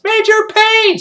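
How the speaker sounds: high, loud call for the major; piercing cadet cry; kid's shout. Acted. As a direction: yell out like a boy in the ranks. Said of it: "And then the kid shouts".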